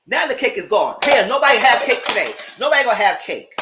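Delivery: loud exasperated declaration; fed-up shout. Real, not acted. Vlogger fed up that the cake is ruined, shouting. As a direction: bark it out, fed up with the whole party. Angry rant about cake.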